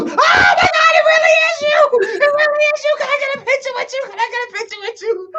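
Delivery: very high squealing fangirl impression; screaming fan. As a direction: shriek in mock hysteria, then race through the request. Fan reacting to the voice, acted.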